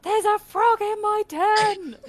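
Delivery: high, drawn-out mock scream; imitating a friend's panicked scream; retold scream. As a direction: shriek it in mock panic, stretching the words. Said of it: "Jem just starts screaming".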